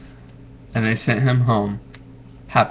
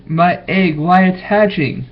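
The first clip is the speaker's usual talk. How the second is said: higher, rising excitement; excited shout; 6 semitones higher, 3 dB louder than usual. A narrator's reading. Horton cries out as the egg hatches. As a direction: Horton can't believe it's hatching. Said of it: "shouted Horton".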